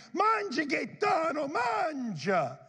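put-on shouted high, urging a kid to eat; Italian grandma calling. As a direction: call out like a nonna at dinner. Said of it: "my parents and grandma".

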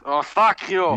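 lightly put-on Yiddish accent. Shown as a demonstration. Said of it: "With my Yiddish accent".

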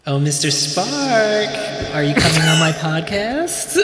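stretched high-pitched call to Mister Spark; mock sing-song call.

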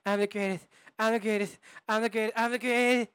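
high, loud lisping boxer voice; Mike Tyson. Acted. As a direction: boast in a mounting high-pitched lisp. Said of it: "Mike Tyson".

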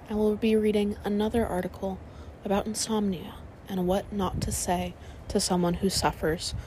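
ordinary delivery

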